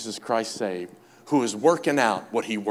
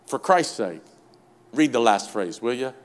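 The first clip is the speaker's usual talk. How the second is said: ordinary talk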